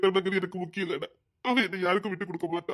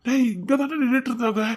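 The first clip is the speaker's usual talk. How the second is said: usual speaking voice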